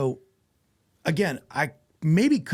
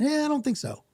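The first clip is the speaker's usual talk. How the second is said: dismissive quote; 11 semitones higher than usual. Retold or imitated. Quoting a dismissive colleague.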